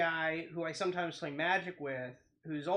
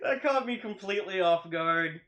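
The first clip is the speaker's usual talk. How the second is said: louder, just recovering from a real laugh; amused, laughing reaction; 3 semitones higher, 7 dB louder than usual. Real, not acted. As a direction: speak through the tail of a laugh. Reacting right after a laughing fit.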